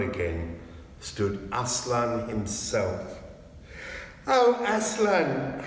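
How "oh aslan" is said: higher, louder, slow cry of joyful fright; astonished cry of a name; children glad and frightened at once. A narrator's reading. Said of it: "cried both the children".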